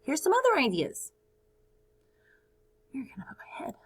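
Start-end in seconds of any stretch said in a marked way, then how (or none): 2.9-3.7 whisper. Real, not acted. Whispered aside.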